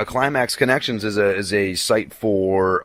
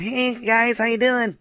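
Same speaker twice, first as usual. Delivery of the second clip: mock high-pitched sweet voice; high boyish voice; 13 semitones higher, slower than usual. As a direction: pitch it way up, imitating a sweet young guy. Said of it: "the opposite type of voice that I like".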